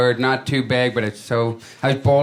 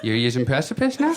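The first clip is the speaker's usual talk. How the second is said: mock incredulous accusation. Comic accuses buddy of taking his word, incredulous. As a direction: challenge him with rising disbelief.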